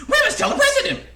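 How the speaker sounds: very high, loud squawking alarm; clucking panicked hen. A narrator's reading. Chicken Licken running in circles after something hit her, frantic high clucking. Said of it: "clucked the Chicken Licken".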